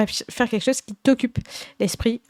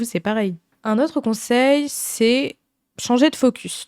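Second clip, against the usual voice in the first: ordinary delivery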